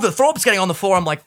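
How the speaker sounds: usual speaking voice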